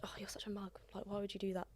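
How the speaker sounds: soft teasing whisper; whispered mock insult. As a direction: hush it like a jab. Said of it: "like, like".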